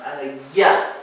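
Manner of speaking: ya isolated and said on its own; the ya sound. Shown as a demonstration. Teacher isolates the ya sound for the student. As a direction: model the lone syllable ya. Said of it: "It has to be".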